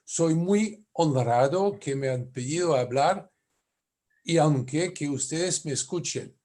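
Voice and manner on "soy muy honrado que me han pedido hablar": normal talking voice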